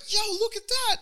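very high-pitched imitation of an awed friend; excited mock exclamation. Imitates friend admiring forearms.

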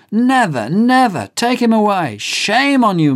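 high, loud furious shouting; outraged crowd cry. Acted. The courtroom crowd shouts at Mister Very Bad, high and outraged. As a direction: scream shame at the prisoner. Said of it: "There's a cry in the court".

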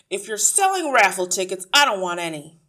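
loud, brusque dismissal; brusque ratty man. A narrator's reading. Ratty man refuses a caller at his door, brusque. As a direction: turn the caller away at full volume.

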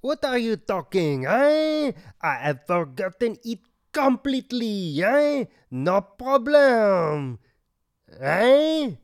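hammed-up French waiter, rising eh on every line; thick mock French accent, eh after each line; comic French accent. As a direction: play the offended French waiter.

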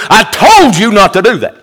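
father scolding a disobedient son, loud and stern; stern rebuke. Pretend. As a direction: let him have it like a fed-up father. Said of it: "The rebuke would be".

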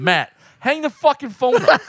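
high, loud pleading; thinking it at the phone, exasperated; exasperated plea. Retold or imitated. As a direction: beg him to hang up. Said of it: "the whole time I'm thinking".

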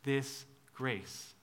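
soft, tender offer; God offering grace, tender and soft; gentle invitation. Retold or imitated. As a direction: invite them softly. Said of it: "he's saying".

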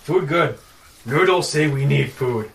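dumb giant voice. Acted. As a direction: boom it out like a dim giant.

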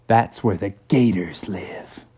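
hushed, low warning whisper; a whispered warning about the swamp; spooky whisper. Acted. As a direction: breathe it like a ghost-story secret. Horses warn of gator swamp.

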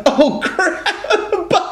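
high whiny laugh through the words; laughing plea. Acted. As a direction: giggle while begging grandpa. Pleading grandson role, laughing.

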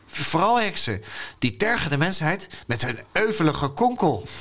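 high-pitched, swooping storybook hero; indignant boy hero. A narrator's reading. Little Fons lecturing farmers about his duty, indignant. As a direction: read it as a grandly protesting little knight.

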